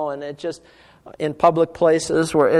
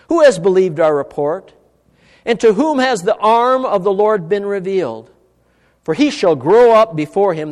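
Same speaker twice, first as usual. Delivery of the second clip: measured, emphatic reading, louder than talk; slow scripture reading; 4 semitones higher, 8 dB louder than usual. Real, not acted. Preacher reading Isaiah to the congregation, slow and emphatic. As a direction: read the verse out deliberately and firmly. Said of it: "I'm gonna read it slowly".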